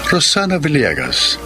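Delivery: ordinary delivery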